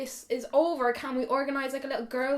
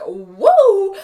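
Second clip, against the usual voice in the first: tipsy excitement; 2 semitones higher, 11 dB louder, slower than usual. Retold or imitated. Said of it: "I am like".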